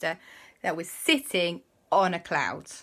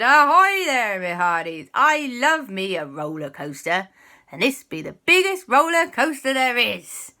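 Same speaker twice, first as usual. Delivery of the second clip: hearty pirate voice, swelling louder; Quasi; 5 semitones higher, 8 dB louder than usual. Acted. Quasi greets friends in the queue, hearty pirate voice. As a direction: play a jolly pirate hailing his mates.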